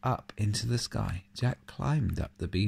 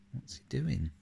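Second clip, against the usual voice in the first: ordinary delivery, nothing marked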